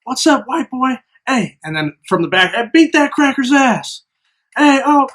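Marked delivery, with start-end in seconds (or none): 0.0-1.6 high-pitched mock challenge; pitched-up taunting call; imitated taunt. Imitates kids running up, taunting. 2.5-5.1 high-pitched calls from the back of a crowd; imitated egging-on. Voices kids egging on from back.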